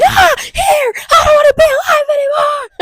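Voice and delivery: high, loud bawling shout; exaggerated hysterical crying yell; mock scream crying. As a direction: wail it like a hammed-up breakdown. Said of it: "scream crying".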